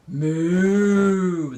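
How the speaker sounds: loud, held cow imitation; a long moo near speaking pitch. Grandma saying milk comes from a cow, mooing. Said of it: "my moos sound kind of funny".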